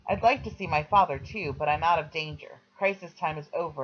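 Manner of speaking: ordinary delivery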